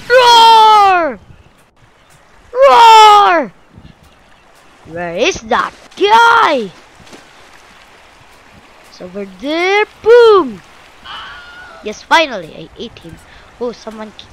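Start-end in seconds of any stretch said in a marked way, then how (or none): 0.1-3.5 loud, high, drawn-out roar; big mock-fierce roar; pretend shark roar. Roaring as attacking shark. 10.0-10.6 loud, high, punched boom; explosive playful boom; imitated impact boom. Voices the impact in game.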